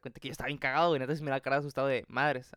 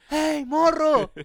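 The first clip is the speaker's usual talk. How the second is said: high, loud, drawn-out holler; full shout; 13 semitones higher, 12 dB louder, much slower than usual. Retold or imitated. Stranger yelling to a kid from the back of the bus, full shout. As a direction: bellow it slowly to someone far away. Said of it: "un señor me grita al fondo".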